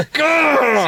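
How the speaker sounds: high, strangled mock scream; hammed-up cry of pain. Acting Peter's scream.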